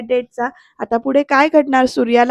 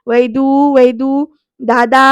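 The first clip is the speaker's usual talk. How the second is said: drawn-out, raised name calls; calling out; 4 dB louder, slower than usual. Retold or imitated. Aunt calling Vaidu behind a shut door, drawn-out calling. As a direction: call through the house for them. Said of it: "आत्या हाका मारतीये".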